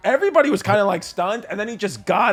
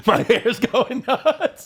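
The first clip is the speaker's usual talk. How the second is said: ordinary delivery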